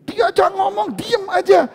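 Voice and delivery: high, loud shouted command; preaching shout. Real, not acted. Preacher telling husbands to stay silent while wives nag, shouting. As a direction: drive the point home at full voice. Urging silence when wife nags.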